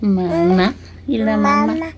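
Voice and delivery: plain reading voice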